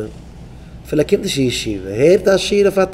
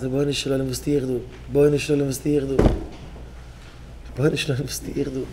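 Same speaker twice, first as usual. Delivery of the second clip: soft plea; 7 dB quieter than usual. Acted. Acted prayer repeated.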